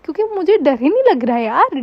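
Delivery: ordinary talk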